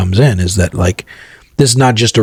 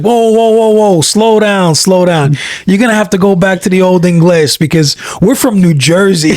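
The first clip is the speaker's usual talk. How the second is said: Jersey guy; 9 semitones higher than usual. Retold or imitated. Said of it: "He's like".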